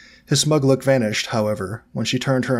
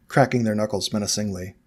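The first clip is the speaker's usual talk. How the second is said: ordinary delivery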